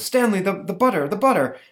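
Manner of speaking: high, flustered dad; flustered father. Retold or imitated. Flustered father calling to his son, high and rattled.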